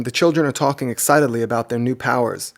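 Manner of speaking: plain reading voice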